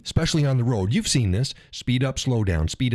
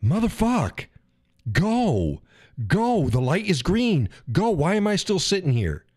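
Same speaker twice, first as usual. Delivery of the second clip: impatient driver rant; 7 semitones higher, slower than usual. Retold or imitated. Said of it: "and you're like".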